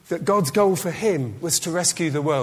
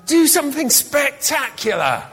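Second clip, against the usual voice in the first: daring devil voice; 6 semitones higher, 5 dB louder than usual. Acted.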